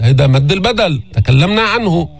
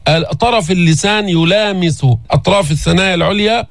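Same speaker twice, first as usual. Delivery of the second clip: ordinary talk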